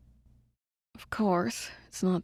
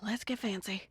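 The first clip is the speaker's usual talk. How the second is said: whispering; 4 semitones higher, 11 dB quieter, slower than usual. Acted. Spy sees target quietly.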